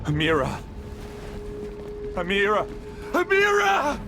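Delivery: panicked calling for Amira; desperate call. Acted. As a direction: cry out her name in fear.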